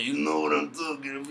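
put-on dad's thick Mexican accent. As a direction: imitate your Mexican father's accent. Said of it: "this is my impersonation of my dad".

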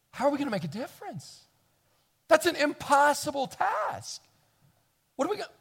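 high, anxious what-do-we-do worry; worried voice. Retold or imitated. Imagined believer fretting at the impossible. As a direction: fret upward like the task is too big. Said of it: "you look at that and you go".

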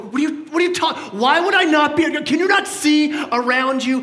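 high, loud disbelieving outburst with a laugh; servant's incredulous, laughing retort. Retold or imitated. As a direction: act baffled, as if he's lost his mind.

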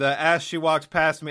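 usual speaking voice

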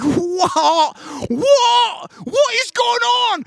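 very high, loud, disbelieving yells; mock shocked shout. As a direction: shriek the what like a stunned dad. Daughter wants to learn fake tan.

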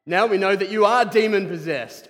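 loud, high angry accusation; accusing shout. Acted. Pharisees accusing Jesus, loud and angry. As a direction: hurl it at him.

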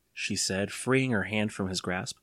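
usual speaking voice

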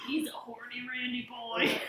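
whispering. Pretend. Whispered tease.

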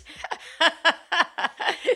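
big high laugh bursting out; loud burst of laughter. Real, not acted. Host laughing at her own realtor joke, loud laugh.

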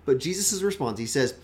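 ordinary delivery

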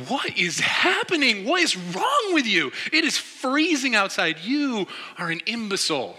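high, loud, exasperated retold rant; hammed-up scolding of an idiot friend; furious disbelief. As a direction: hammer out each question in outraged disbelief. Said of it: "getting more and more furious at him".

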